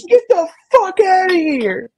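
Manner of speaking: very high, slow mock shout; high-pitched imitated wife yelling. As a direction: shriek it like a startled wife. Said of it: "she goes".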